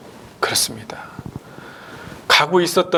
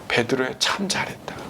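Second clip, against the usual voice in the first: soft, lowered voice of tender praise; acted reassurance, quietly proud; gentle encouragement; 3 semitones lower, 6 dB quieter than usual. Jesus praising Peter for stepping out, gentle encouragement. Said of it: "격려하는 그 이야기로".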